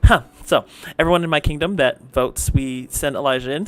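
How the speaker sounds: ordinary delivery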